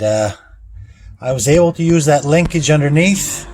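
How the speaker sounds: normal talking voice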